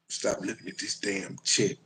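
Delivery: ordinary talk, nothing marked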